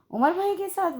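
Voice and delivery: high, startled echo of the answer; a sharp rise of disbelief; surprised. A narrator's reading. Sumbal hears who brought her, surprised. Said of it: "वो हैरान हुई".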